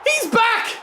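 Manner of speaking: high, loud repeat of the cheer; excited shout. Real, not acted. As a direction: shout it again with joy.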